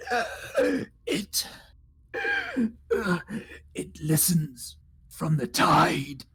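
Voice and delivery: halting, high dying croak; dry cracking voice. Pretend. Dying drow gives a last warning, dry cracked voice. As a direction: force out last words, slowly, with rasping breaths. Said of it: "has this dry voice that cracks".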